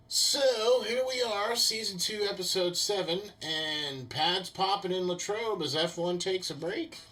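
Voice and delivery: high-pitched show kickoff; episode-opening announcement. Acted.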